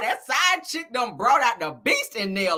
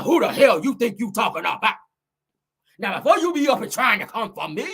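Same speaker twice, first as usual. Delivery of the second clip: put-on, forceful angry-woman voice; rival's indignant voice. Acting the rival confronting a gossip host, indignant. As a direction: throw it out as a shouted challenge. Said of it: "reading the girls and Nell voice".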